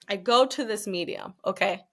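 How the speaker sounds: ordinary delivery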